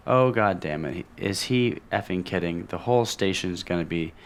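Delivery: normal talking voice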